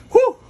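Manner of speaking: high loud excited whew; hyper whew. Real, not acted. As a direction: let out a giddy high whoop of breath. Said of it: "Hyper, eh, no?".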